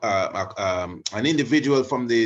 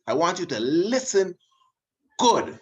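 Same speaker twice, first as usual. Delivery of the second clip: urgent insistence; 9 semitones higher, 4 dB louder than usual. Real, not acted. Urging audience to listen closely.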